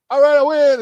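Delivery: high, loud offhand gloat; mock smug. Rey shrugging off her victory, mock smug. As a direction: toss off the win like nothing.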